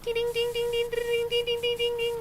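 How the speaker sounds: very high chiming ding ding; mimicking car-cleaning game dings, high chimes; imitating ding sound. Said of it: "It's like".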